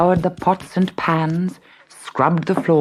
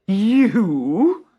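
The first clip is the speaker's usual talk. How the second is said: scornful stepmother; 5 semitones higher, 4 dB louder than usual. Acted. Stepmother scoffs.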